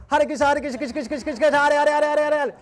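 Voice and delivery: high, loud gabbled mimicry of a chant; mocking drunk. Drunk man parrots the scared singer, high gabble. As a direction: rattle off the chant at a high pitch. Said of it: "this drunken guy goes".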